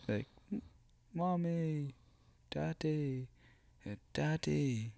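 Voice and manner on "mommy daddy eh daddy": higher and softer, a hint of a whimpering child; soft mock child. Host imagines how someone would say it, softly whimpered. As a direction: whimper it like a small lost child. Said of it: "he would say that".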